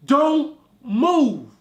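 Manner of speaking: very loud, high warning; urgent shout. Retold or imitated. Warning friend as lion closes in, urgent. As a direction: shout a warning not to move. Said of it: "and I say".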